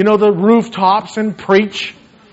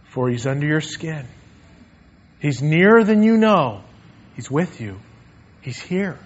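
ordinary delivery

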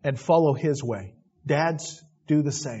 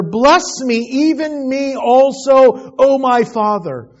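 pleading cry; 9 semitones higher, 8 dB louder, slower than usual. Acted. Esau begging his father.

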